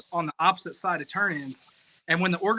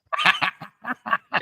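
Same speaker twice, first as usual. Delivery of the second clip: short burst of laughter; 2 semitones higher, 3 dB louder than usual. Real, not acted. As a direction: laugh it off before answering. Speaker laughs after being questioned.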